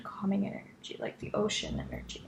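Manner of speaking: soft unvoiced whisper. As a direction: breathe the words out gently. Healer soothing during guided healing.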